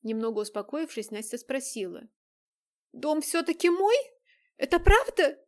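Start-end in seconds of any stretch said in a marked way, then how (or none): 2.9-5.4 higher, louder urgent asking; anxious pleading. Acted. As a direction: beg to hear it's true. Nastya anxiously seeking confirmation.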